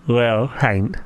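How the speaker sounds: lips can't touch, f slurred; mouth held open. Real, not acted. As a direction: say it with lips apart. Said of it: "the mouth is wide open".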